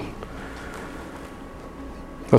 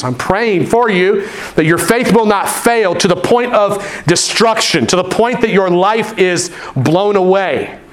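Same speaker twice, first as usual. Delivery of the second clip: lightly raised sermon voice; preaching; 6 semitones higher, slower than usual. Acted. Pastor reassuring his church, lightly raised preaching. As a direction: reassure the congregation, lifting the tone.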